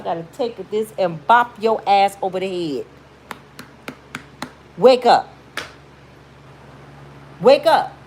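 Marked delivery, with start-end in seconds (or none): 4.8-5.3 loud wake-up call. Acted. To a heartbroken friend, a loud sharp wake-up call. Shaking a friend out of self-pity. 7.4-7.9 louder wake-up call. Acted. To the same friend, an even more insistent loud call. Repeats the call, pitched higher.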